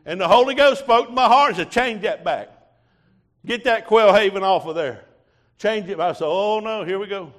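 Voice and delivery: loud, high testimony from the pulpit; preaching at full force. Real, not acted. Preacher testifying to his church, loud and fervent. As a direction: preach it out to the congregation.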